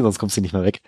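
normal talking voice

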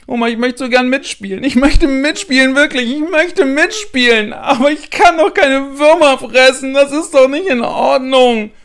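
put-on high, loud wailing child's plea; crying girl begging her grandma; tearful pleading. A narrator's reading. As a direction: beg through tears like a little girl. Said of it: "beginnt fürchterlich zu weinen".

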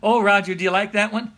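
gasping out the words between laughs; laughing hard. Real, not acted. Pastor relaying his wife's quip, crying with laughter.

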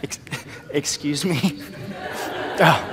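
startled laugh of disbelief; laughing surprise. Retold or imitated. A shy student laughing in shock at his professor's request. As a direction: caught off guard, laugh it out. Professor asks him to speak.

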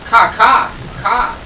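high loud screeching caws; shrill screeching bird woman. Acted. Imitating game bird cry.

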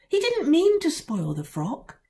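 put-on high child voice insisting; a little girl insists firmly. A narrator's reading. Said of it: "insisted Lucilla".

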